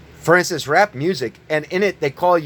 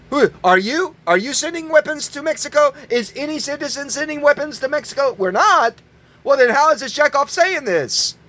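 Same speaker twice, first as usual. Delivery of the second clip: indignant outrage; 8 semitones higher than usual. Real, not acted. Indignant challenge to listeners.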